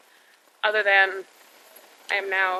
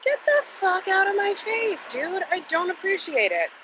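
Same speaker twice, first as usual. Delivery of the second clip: much higher, fed-up mock rant; exasperated outburst; 11 semitones higher than usual. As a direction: throw your voice way up in disgust. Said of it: "retelling harasser annoyance".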